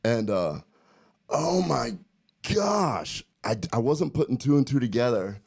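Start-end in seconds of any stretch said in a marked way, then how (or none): 1.3-3.2 high, drawn-out GOSH with a pause before it; exasperated emphasis. Real, not acted. Host recalling supplement side effects, exasperated emphasis. Dramatizing his heartburn misery.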